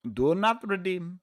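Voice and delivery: put-on high, slow Indian accent meme voice. Streamer trying the requested Indian accent, mock and high. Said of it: "Indian accent".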